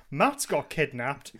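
ordinary delivery

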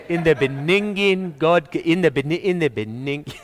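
halting mock misreading, "Beninging" for beginning; bungled reading. Shown as a demonstration. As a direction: keep tripping over "Beninging", restarting as a poor reader would. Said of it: "I think I could do it".

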